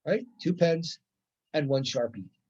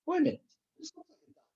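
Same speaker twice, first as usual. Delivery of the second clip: high-pitched acted double take; high playful wait-a-minute; mock surprise; 12 semitones higher, 3 dB quieter, much faster than usual. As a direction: sound like it just dawned on you. Learner realizing it's a duck.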